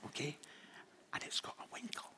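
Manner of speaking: soft unvoiced whisper; whispering over a crib. Real, not acted. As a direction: breathe the words without voice. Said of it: "I'm gonna speak quite quietly".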